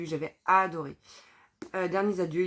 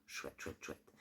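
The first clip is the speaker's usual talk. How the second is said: murmure presque sans voix; chuchoté; 17 dB quieter, much slower than usual. Real, not acted. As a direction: souffler « chouette » pour soi. Lit une dédicace, souffle.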